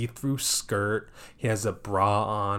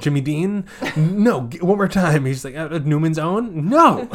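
higher, louder playful back-and-forth; excited guessing game; 6 semitones higher, 9 dB louder than usual. Retold or imitated. Retelling the couple's guessing game, loud and playful. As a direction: bounce the guesses and the no.

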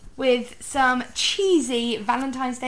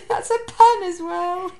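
laughing; 9 semitones higher, 6 dB louder than usual. Real, not acted. Laughing after the pun.

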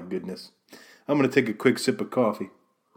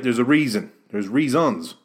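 ordinary delivery

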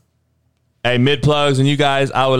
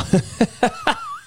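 laugh; 5 dB quieter than usual. Real, not acted. Laughs after his point.

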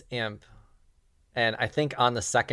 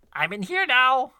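high, loud put-on shout; voice from behind; 14 semitones higher, 6 dB louder than usual. Game master voices someone heard behind the players, high shout. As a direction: call out high and loud to announce yourself. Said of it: "you hear".

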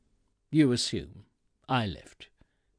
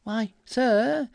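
high, loud mock-servile address; obsequious sarcasm; 10 semitones higher, 6 dB louder than usual. A narrator's reading. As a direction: fawn sarcastically at the customer. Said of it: "using an obsequious tone to compliment his sarcasm".

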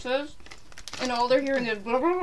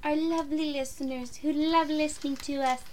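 sweet, sugary flattery; butter up the listeners; suck-up voice; 2 semitones higher, 4 dB quieter than usual. Pretend. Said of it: "You're such a suck-up".

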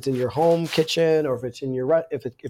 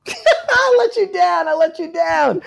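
loud, high-pitched laughing protest; laughing outburst; 6 dB louder, slower than usual. Real, not acted. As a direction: cry it out through real laughter, high and loud. Guest laughs at letting host down.

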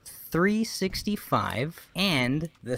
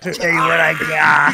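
hyped shout; 4 semitones higher, 11 dB louder than usual. Real, not acted. Shouted teaser to host.

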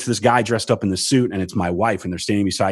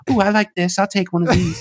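a hint of [name]; 8 semitones higher than usual. A touch of the star cooing over magic tricks. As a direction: coo over the shelves, delighted. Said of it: "he's on the other side just shopping".

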